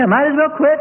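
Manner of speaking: high, loud whiny giving up; mock defeated believer. Preacher imitating a churchgoer ready to quit, high and whiny. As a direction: toss in the towel with a whine.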